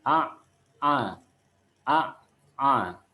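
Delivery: "A" squeezed from the middle of the throat; mid-throat ain. Shown as a demonstration. Teacher modelling ain for pupils, "A" from mid-throat. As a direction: make each "A" an Arabic ain. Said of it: "huruf Ain sama, masih di tenggorokan bagian tengah".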